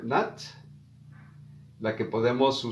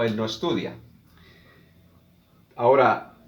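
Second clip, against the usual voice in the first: ordinary talk, nothing marked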